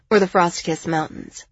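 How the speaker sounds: usual speaking voice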